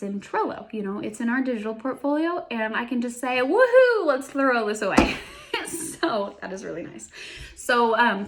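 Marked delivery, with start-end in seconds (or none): 3.4-5.2 excited cheer. Retold or imitated. Voicing her own cheer.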